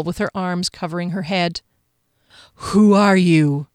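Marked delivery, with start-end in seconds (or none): 2.7-3.7 loud, stern challenge; commanding interrogation; menacing guard. A narrator's reading. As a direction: demand it as a ghostly sentinel.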